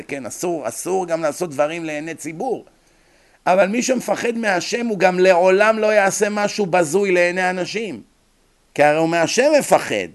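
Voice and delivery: loud, impassioned sermon; fiery preacher; forceful preaching. Real, not acted. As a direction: hammer home the moral to the congregation.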